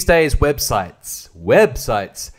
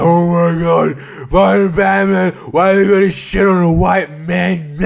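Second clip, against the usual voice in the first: louder, higher, slow indignant wail; over-the-top scandalized shout; mock outrage; 5 semitones higher, 6 dB louder, slower than usual. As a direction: parody an offended viewer crying foul. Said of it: "a lot of people were like".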